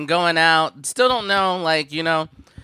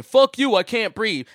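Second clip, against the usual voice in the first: mocking anti-masker; 7 semitones higher, 4 dB louder than usual. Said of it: "you might get mad at me for saying".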